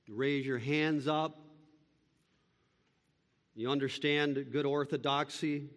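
normal talking voice

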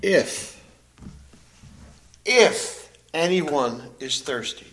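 slow down and weigh the word "If"; stress on If. Shown as a demonstration. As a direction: lean hard on "If", then go slow. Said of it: "notice that first word".

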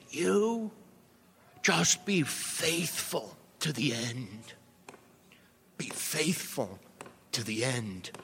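a hint of an old preacher, slow and deliberate; slow, emphatic old pulpit voice, lightly done. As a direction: lightly imitate a ninety-nine-year-old preacher urging you on. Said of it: "he would say".

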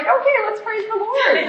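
high, laughing fake-sweet voice; sudden sugary church voice; laughing at her own fake calm at church; mock cheery switch. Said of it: "you get there and you're like".

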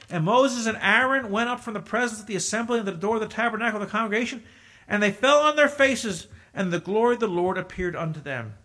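fast, loud Bible reading; scripture reading. Acted. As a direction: read the verse aloud to the congregation.

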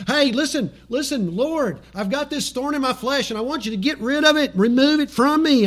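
high-pitched, urgent pleading; acted insistent prayer. Believer begging the Lord to remove a thorn, acted insistence. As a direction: press the Lord with mock insistence.

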